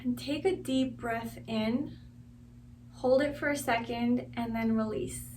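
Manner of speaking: soft, unhurried meditation guide voice; guided meditation. Real, not acted. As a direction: guide them gently through each breath, quietly. Leads a guided breathing meditation.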